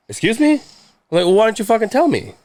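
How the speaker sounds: high-pitched retold indignation; indignant. Grown son to dad who went home, indignant. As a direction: voice jumping up in disbelief.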